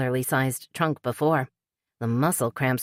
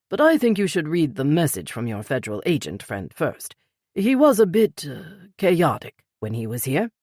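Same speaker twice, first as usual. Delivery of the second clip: lightly voiced older British man; a gentle hint of the polite Peter; older British gentleman, lightly voiced; Peter's voice. A narrator's reading. Peter's voice in read story.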